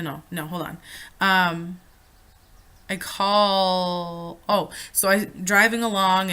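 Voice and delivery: ordinary talk, nothing marked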